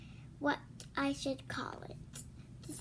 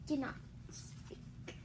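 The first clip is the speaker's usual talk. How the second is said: slow hushed whisper; slow whispered warning; dramatic whisper; 7 dB quieter, slower than usual. Acted. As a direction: breathe it out slowly.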